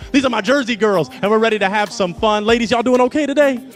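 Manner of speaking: bright, swooping show-host patter, big rises on the hype words; game show host. Acted. Hosts the dating show.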